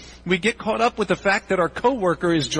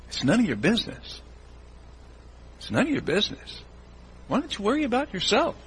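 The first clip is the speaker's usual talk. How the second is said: quiet, firm rebuke; Jesus hushing a busybody; Jesus, gently; 4 semitones higher, 5 dB quieter than usual. Acted. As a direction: softly tell them to mind their own business. Jesus voiced rebuking the congregation.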